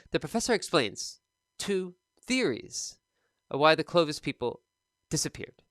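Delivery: mild imitation of word-by-word choppy reading. Teacher mimicking a student's choppy reading for learners. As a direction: copy the student's halting, one-word-at-a-time delivery. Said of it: "very choppy".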